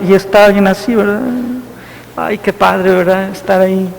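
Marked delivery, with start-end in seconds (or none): 2.1-3.9 mumbled low and sleepy, much softer; drowsy parishioner. Retold or imitated. Priest mimics a parishioner dozing in the pew, sleepy mumble. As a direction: drift off mid-sentence like nodding in the pew.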